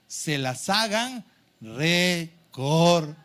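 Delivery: "recordar" broken slowly into syllables; "recordar" very slow, each syllable set apart; drawn-out emphasis. Real, not acted. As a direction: stretch "recordar" out as re, cor, dar. Preacher spells out the key word.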